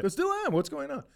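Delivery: Vince Vaughn. Retold or imitated. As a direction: play the cheerful faded star. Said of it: "And he's like".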